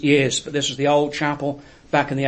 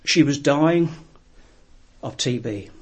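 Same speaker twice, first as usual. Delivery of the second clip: ordinary delivery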